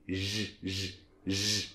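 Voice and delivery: soft "J" like jazz without the D, very quiet; French J sound. Shown as a demonstration. Teacher demonstrating the French J to learners. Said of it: "vous pensez à jazz et vous sortez ce D".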